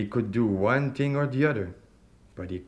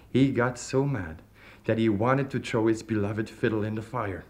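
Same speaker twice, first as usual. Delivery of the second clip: plain reading voice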